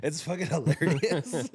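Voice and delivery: slow, laughing delivery. Real, not acted. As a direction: still cracking up. Cracking up over the ad.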